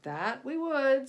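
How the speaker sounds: slow, loud gruff captain; captain agreeing. A narrator's reading. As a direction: weigh it out like an old skipper. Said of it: "said the captain".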